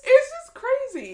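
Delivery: high, loud and slow disbelief; exasperated. Real, not acted. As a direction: stretch it out in disbelief. Exasperated remark.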